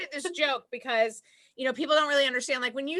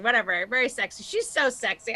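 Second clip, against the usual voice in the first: ordinary talk, nothing marked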